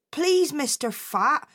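pleading slowly with the boss; a touch of begging; mild begging. Acted. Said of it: "he begged his boss".

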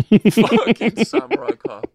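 high, drawn-out words through laughter; real laughter breaking up the words; helpless laughing. Real, not acted. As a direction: squeeze the words out while cracking up. Still cracked up by joke.

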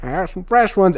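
put-on high old-lady offer of treats; imitated very old lady. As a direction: put on a ninety-year-old granny. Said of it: "she was ninety-some years old".

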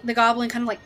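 ordinary talk

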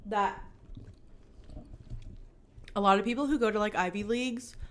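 chewing boba while talking; mouth full. Real, not acted. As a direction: talk around a mouthful. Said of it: "I'm eating my boba".

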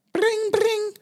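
high mock phone ring; imitating a ringing phone. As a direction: ring like an old telephone.

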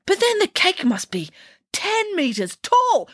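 high astonished princess gasp; stunned by the size. Acted.

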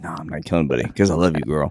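mock deep. Sam telling Martha he won't kill, low and fast. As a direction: drop low and rush it.

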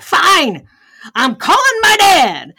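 grudging angry outburst, hammed up; mad retort. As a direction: bark it out like you're fed up with the voice. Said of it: "I was mad at that point".

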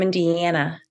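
INDIANA with a stretched "an"; regional vowel in Indiana. Shown as a demonstration. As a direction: lengthen the "an" in "Indiana". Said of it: "That's how I say it".

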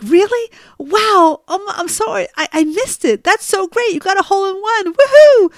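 high, over-the-top delighted praise; high, bubbly mom ending in a loud cheer; gushing mom. Retold or imitated. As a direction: gush like a thrilled mother. Mom gushes over son's hole in one.

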